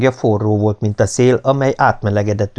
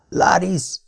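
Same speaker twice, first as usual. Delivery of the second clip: higher-pitched, hoarse call of a name; 10 semitones higher than usual. A narrator's reading. Bound man calling after the vanished woman, hoarse. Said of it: "mondtam rekedten".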